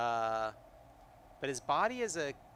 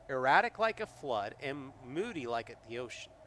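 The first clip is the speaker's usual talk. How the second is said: normal talking voice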